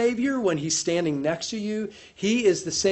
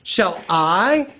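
louder "Shall I?" with rising tune; stress on shall I; 2 semitones higher, 5 dB louder than usual. Shown as a demonstration. Preacher ratcheting up the tension. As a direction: punch "Shall I" as a pointed question. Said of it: "ratchets it up the tension".

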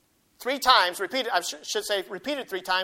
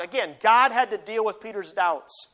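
usual speaking voice

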